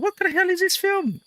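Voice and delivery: squeaky high baffled voice. Retold or imitated. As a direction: squeak it out, confused. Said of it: "I thought".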